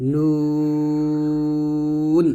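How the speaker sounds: "নুন" with a very long "oo"; tongue-tip N. Shown as a demonstration. Teacher demonstrating nun from the tongue tip, prolonged. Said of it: "তালুর সঙ্গে লাগিয়ে উচ্চারণ".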